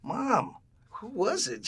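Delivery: high, pleading acted question; high-pitched imitation of his pestering self; exasperated son. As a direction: whine at mom in mock exasperation. Exasperated son pressing his mother.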